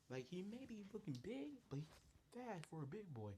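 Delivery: high, insistent warning; acted alarmed, high-pitched caution; urgent warning. Charlie warns about fast dad.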